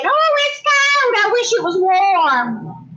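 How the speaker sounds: drawn-out, high-pitched whiny complaint; mock griping. Imagining herself complaining if it were cold here, mock griping. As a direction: gripe in a hammed-up high voice, stretching the words. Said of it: "I'd be griping and saying".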